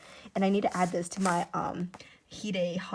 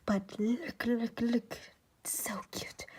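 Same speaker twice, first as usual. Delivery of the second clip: excited whisper; hushed whisper; 3 dB quieter, slower than usual. Real, not acted. Showing art while someone is sleeping, hushed whisper. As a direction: whisper so as not to wake anyone. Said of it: "I'm being really quiet".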